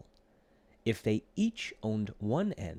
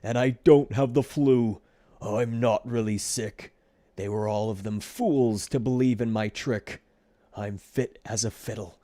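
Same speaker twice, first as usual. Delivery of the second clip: gloating; 7 dB louder than usual. A narrator's reading. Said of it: "he sang louder and louder".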